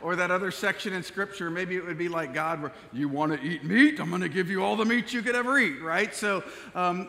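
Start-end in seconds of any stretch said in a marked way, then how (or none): 2.9-5.8 a hammed-up booming God, louder on the first line; terrible God voice. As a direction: play God as a half-hearted mock decree, fast. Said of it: "those were terrible, terrible voices".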